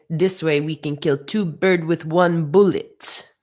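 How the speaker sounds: hammed-up Russian accent; imitated Russian boss's accent; bad Russian accent. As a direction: do a not-very-good Russian accent. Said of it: "that was not a very good Russian accent".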